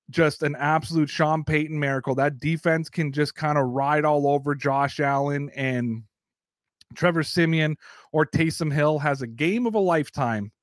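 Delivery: ordinary talk, nothing marked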